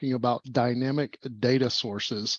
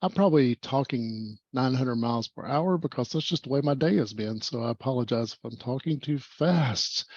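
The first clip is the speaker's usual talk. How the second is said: ordinary talk, nothing marked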